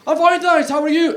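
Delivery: high, loud imitation of a Scottish accent; mock Scottish accent. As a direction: copy the Scotsman's accent for a laugh. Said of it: "he mimicked my accent".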